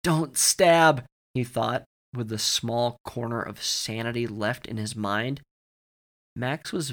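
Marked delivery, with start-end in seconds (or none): 0.0-1.0 louder, higher cry of inner panic; urgent, pitched-up plea to himself; pained exclamation. A narrator's reading. Max begging himself not to stab, pained and loud. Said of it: "Max howled in pain".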